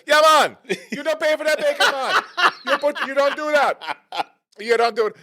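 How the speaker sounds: high, loud Jamaican scolding impression; shrieky imitation Jamaican cafeteria worker; mock Jamaican accent. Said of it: "only a Jamaican would do this".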